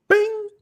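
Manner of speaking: high, short imitated bing; showing viewers the sound of hitting like. Shown as a demonstration. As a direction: make the little ding of a notification. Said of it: "demonstrating the like sound".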